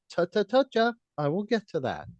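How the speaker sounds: high, patronizing scolding tone; condescending voice. Pretend. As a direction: talk down like a smug teacher. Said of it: "condescending voice I have".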